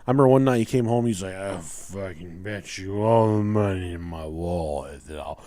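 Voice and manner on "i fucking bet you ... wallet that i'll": put-on slow, low drunken slur; drunk brother. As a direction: imitate a hammered brother dragging out a bet. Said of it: "getting fucking hammered every night".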